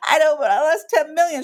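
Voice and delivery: a tearful complaint; whiny protest. Retold or imitated. Telling her friend about the loss, high whiny protest.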